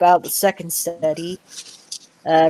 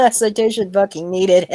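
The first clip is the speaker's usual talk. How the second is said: laughing through the words; real laughter; 3 semitones higher than usual. Real, not acted. Scoffing with friends at an unsupported article, laughing. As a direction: crack up while saying it.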